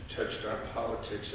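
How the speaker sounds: usual speaking voice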